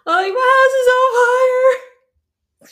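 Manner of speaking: long, high, crying shout; hysterical wail. Retold or imitated. Telling his sister of the fire, re-enacted hysterical wail. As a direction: re-enact a wailing panic, drawn out and loud. Retells frantic call to sister.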